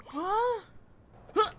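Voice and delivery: high, loud startled "huh". Real, not acted. Gamer reacting to a sudden game moment, startled. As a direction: blurt it out high in surprise.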